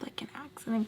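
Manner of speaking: whispered, hushed aside; urgent whisper. Retold or imitated. Urgent aside at car window.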